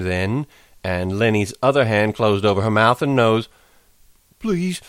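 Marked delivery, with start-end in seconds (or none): 4.4-4.8 begging. A narrator's reading. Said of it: "he begged".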